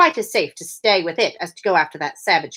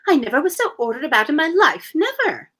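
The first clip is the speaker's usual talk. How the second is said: put-on huffy high little girl; indignant girl; 7 semitones higher than usual. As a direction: complain like an offended child. Alice indignant in story.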